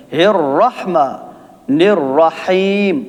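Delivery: الرحمن الرحیم slow with full heavy ra; slow recitation with heavy ra. Shown as a demonstration. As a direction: draw out the ra in الرحمن. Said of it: "را کو موٹا پڑھیں گے".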